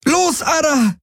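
very high desperate cry; desperate scream. Acted. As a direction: scream at the animal in despair. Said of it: "schrie Kirai verzweifelt".

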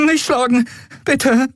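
cowering from a blow; panicked plea. Acted. Miko begging the Skria not to hit him, panicked.

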